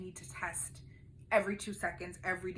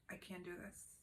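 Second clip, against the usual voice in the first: much softer, drained and hopeless; hopeless near-whisper; 14 dB quieter than usual. Retold or imitated. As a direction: let it barely come out. Retold despair to husband.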